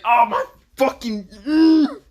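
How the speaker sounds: high, strained, loud cursing; pained cussing. Acted. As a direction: sputter curses through acted agony. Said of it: "He's just right now cussing".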